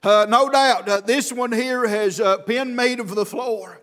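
chanted preaching cadence gathering force; cadenced preaching. Real, not acted. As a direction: preach in rhythmic bursts with gasped "uh"s, climbing to a shout. Preacher's cadenced sermon, building in force.

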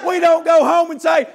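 loud, high preacher's exhortation; full-force revival sermon; fiery preaching. Acted. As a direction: preach it hard to the congregation.

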